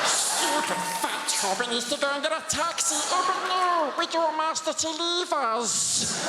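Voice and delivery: high hissing Gollum, laughing through it; high whining creature voice breaking into laughs. Retold or imitated. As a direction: plead like Smeagol to his master. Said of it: "as Gollum".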